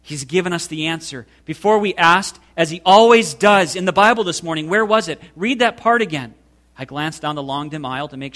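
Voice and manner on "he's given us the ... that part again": higher and louder, rushing with eagerness; light up as the answer comes to you; excited. A narrator's reading. Betsy urging her sister to reread the verse, excited. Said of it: "she said excitedly".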